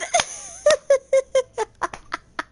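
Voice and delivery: high, loose peal of laughter; real high laughing fit, trailing off; cracking up. Real, not acted. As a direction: laugh helplessly at the running gag. Streamer cracks up at running gag.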